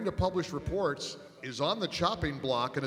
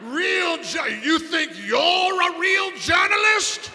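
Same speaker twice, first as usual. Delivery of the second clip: much higher, much louder furious mock bellow; Gorka bellowing; 14 semitones higher, 9 dB louder, slower than usual. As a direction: roar it at the reporter like an outraged man. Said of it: "you hear him start bellowing".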